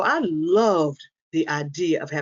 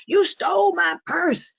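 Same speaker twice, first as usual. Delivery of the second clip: high, drawn-out mock accusing yell; imitated scream; 13 semitones higher, slower than usual. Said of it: "my mother would scream and yell".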